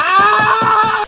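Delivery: high-pitched wail; high yelp. Retold or imitated. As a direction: cry out "ah", high and sustained. Outburst while telling wild drive.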